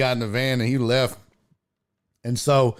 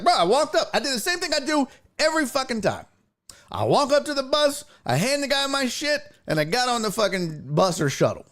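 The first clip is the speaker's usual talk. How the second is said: high-pitched, indignant retelling; exasperated self-defence; 11 semitones higher than usual. Retold or imitated. Customer explains himself to supervisor, exasperated. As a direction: insist you did nothing different.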